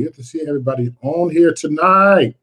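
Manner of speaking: big, drawn-out hype-host welcome; excited greeting. Real, not acted. Host welcomes everybody on the livestream, excited. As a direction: open the show loud and high.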